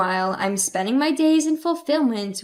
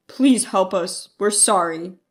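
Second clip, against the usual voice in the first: begging father; 6 semitones lower than usual. A narrator's reading. Father begs daughter for help, low and pleading. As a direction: beg in a lowered father's voice. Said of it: "my father begged".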